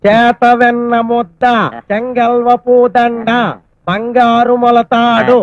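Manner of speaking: slow recitation. Acted. Boy recites poem slowly.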